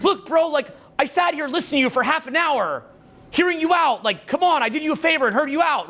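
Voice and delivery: high, whiny complaint; indignant friend. Retold or imitated. Knucklehead friend complaining to you, high and whiny. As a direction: play a put-upon friend. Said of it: "they'll be like".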